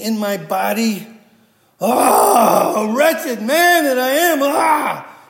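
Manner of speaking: loud, high anguished groaning, crying out; groaning Paul. Retold or imitated. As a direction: wail it like a man tormented by his own sin. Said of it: "the inflection in the voice and the groaning".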